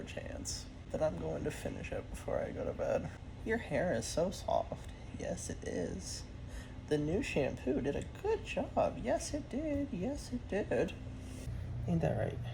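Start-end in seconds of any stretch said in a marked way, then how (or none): 3.4-12.4 lilting, cooing praise; soft pet talk. Real, not acted. Owner praises pet's soft hair after new shampoo, singsong cooing. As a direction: fuss gently over a pet in singsong.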